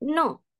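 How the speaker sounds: NO starts high and drops sharply; fourth tone, jerked. Shown as a demonstration. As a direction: snap no downward from a high start. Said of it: "Kita sentak". (no disentak dengan nada empat, tinggi lalu turun)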